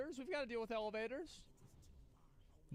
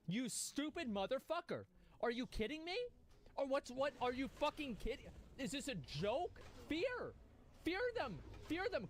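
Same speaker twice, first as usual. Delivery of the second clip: high, frantic outburst of disbelief; real panicked anger, climbing in pitch; exasperated yelling; 5 semitones higher than usual. Real, not acted. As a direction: berate a teammate, rising to urgent high commands. Yelling at teammate's blunder in game.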